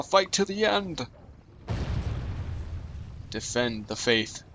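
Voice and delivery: rally the men to a last stand; roaring rallying speech. Pretend. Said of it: "They're like".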